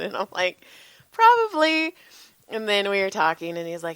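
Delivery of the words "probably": playful agreement. Retold or imitated. Joking reply about youngest son.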